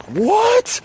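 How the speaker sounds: very high, squeaky, scandalized voice; hammed-up high indignation; indignant outrage. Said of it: "this is when I'm like".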